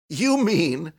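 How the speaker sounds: loud, high indignant cry. A narrator's reading. Young man protesting to the girl, indignant. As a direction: cry out, affronted. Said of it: "he cried indignantly".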